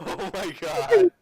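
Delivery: slow, high, helpless laughing groan; laughing disbelief. Real, not acted. Game master reacting to the window escape, laughing in disbelief. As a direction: draw it out through loud laughter.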